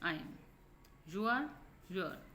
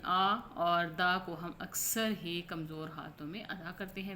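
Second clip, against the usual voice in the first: ordinary talk, nothing marked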